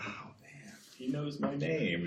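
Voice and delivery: hushed, breath-only start, then soft and drawn out; soft, slow imitation of an impressed student; mock awe. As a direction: pretend to be quietly amazed. Imagines impressed student being recognised.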